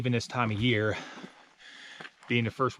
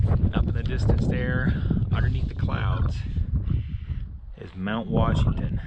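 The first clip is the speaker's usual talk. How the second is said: summit announcement; 4 dB louder than usual. Real, not acted.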